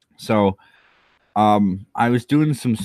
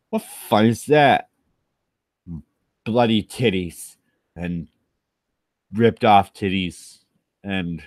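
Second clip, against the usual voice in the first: ordinary talk, nothing marked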